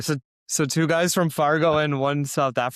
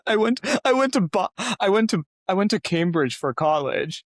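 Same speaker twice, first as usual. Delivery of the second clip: mock humblebrag; 6 semitones higher, 4 dB louder than usual. Friend parodies a mate's boasting, mock humblebrag. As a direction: tease with a fake boast. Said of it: "what a douche. That's like saying".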